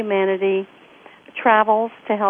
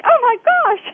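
high, loud mock astonishment; amazed; 7 dB louder than usual. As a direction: act out a big gasping-in-wonder reaction. Retelling her amazed reply.

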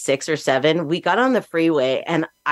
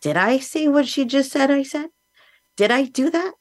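high, disbelieving inner question; incredulous self-questioning; 10 semitones higher than usual. Retold or imitated. Questioning herself about what mom claimed, high and incredulous. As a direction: ask yourself in high-pitched disbelief. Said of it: "think to yourself".

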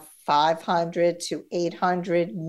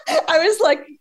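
laughing; 10 semitones higher, 4 dB louder, faster than usual. Real, not acted. Laughs at own embarrassment.

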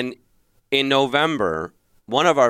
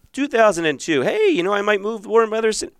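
mock upbeat self; 12 semitones higher than usual. Mimicking his own empty optimism.

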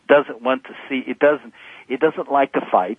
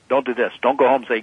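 ordinary delivery

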